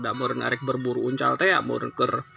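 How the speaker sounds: plain reading voice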